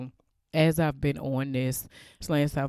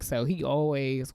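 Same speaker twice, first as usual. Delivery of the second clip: ordinary talk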